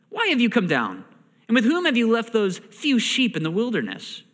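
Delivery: a touch of angry accusation. Acted. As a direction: read it as an irritated older brother's reproach. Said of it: "Eliab's anger was kindled against David".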